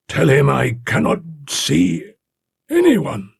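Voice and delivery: high, slow, plaintive complaint; complaining. A narrator's reading. Voice from within refusing the visitor, complainingly. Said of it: "it said complainingly".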